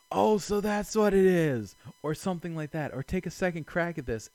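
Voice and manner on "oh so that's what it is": 1 sudden realization. Pretend. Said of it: "and just be like".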